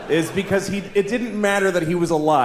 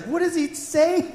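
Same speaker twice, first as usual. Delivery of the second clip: curious daughter; 11 semitones higher than usual. Retold or imitated. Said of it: "My daughter's like".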